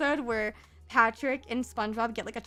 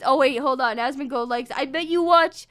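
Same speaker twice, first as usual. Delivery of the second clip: louder, higher hammed-up accusing rant; mock rant; 4 semitones higher, 8 dB louder than usual. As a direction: accuse chat theatrically. Mock ranting accusations at chat.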